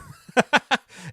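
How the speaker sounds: loud, high laugh; big amused cackle at a joke; burst of laughter. Real, not acted. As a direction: crack up laughing loudly.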